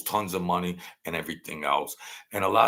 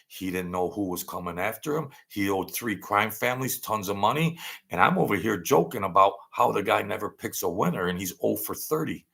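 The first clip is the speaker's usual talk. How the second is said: ordinary talk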